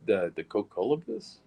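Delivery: ordinary talk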